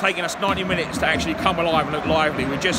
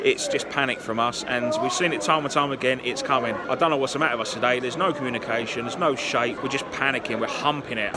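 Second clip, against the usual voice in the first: ordinary talk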